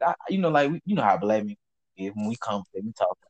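usual speaking voice

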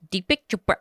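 a silly put-on voice for the name. Shown as a demonstration. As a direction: say the name in a goofy voice. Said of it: "I was just trying to do a silly one".

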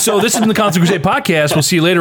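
podcast sign-off. Pretend. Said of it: "mock podcast sign-off".

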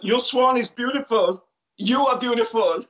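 high, stilted mock Belgian accent; Jean-Claude Van Damme accent. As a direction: ham up a Belgian action star's accent. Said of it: "That's your award-winning J C V D accent".

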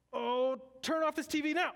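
mock the desperate prophets in a high pleading whine; whiny prophets. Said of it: "These prophets are saying".

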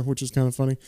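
usual speaking voice

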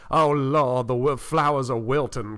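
genteel, Frenchy Southern accent; Louisiana Southern accent. Shown as a demonstration. Telling how Southern accents differ by state, imitated Louisiana accent. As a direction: put on a light Louisiana belle's Southern accent. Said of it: "Louisiana, I think is, like, where you hear that".

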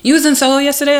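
high, loud pushy stranger voice; imitating the guy. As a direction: push the question hard and high. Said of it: "he goes".

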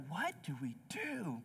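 soft, hushed, lost question; hushed despair. Retold or imitated. Parents in tears on the plane, hushed despair. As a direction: whisper it like you're out of answers.

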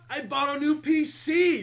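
loud, high, proud reveal; excited announcement. Real, not acted. Host announcing his new computer to listeners, excited. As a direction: belt out the big news.

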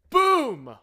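high, loud, hammed-up alarm; mock dramatic announcer. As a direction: cry out the disaster. Narrating internet cutting out.

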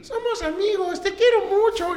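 squeaky high talking doll; high cutesy doll voice. Retold or imitated. Doll voice in retold movie.

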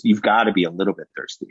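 fast, light coaxing of a stubborn crowd; quick coaxing. Retold or imitated. Jesus coaxing the offended crowd, quick and light. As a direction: rattle off a gentle nudge. And he goes.

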